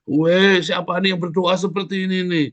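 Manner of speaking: high-pitched cry of amazement, opening loud; God's excited exclamation. Acted. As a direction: call out high, as God searching for who prayed. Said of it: "dicari kamu sama Tuhan". (seruan kagum Tuhan, melengking tinggi)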